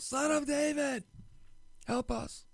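high, stretched imitation of a yell; mock yelling out. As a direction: call out high like someone pleading from afar. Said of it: "these guys are yelling out".

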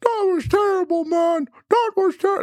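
unpracticed Kermit impression, way up high. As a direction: squeeze it up into a bad Kermit the Frog. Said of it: "that was my Kermit voice".

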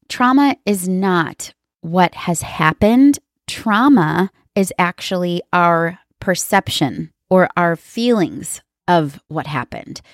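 slow, weighted phrases with "not" and "perception" set apart; deliberate emphasis. Real, not acted. Therapist stressing her key point from sessions, slow and emphatic. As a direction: spell out "Trauma is not what has happened" slowly, with pauses. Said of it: "I will always emphasize this".

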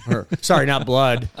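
laughing through the words; chuckling at own slip. Real, not acted.

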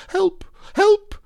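high shrieking call for help; panicked cry. Acted. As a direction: squeal it in terror. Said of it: "cried Piglet".

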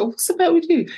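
puzzled asking. Retold or imitated. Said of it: "I said".

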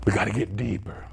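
low mock-profound voice; mock deep believer.

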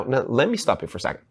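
ordinary talk, nothing marked

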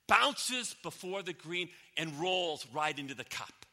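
ordinary talk

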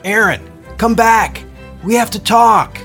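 loud, high pleading shout; shouting after her. Acted. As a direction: call her back from the dock. Gordon shouting to departing Erin.

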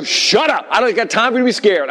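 high, urgent scolding shout; retold scream. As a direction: re-enact a panicked dad yelling. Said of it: "I just screamed".

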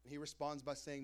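normal talking voice

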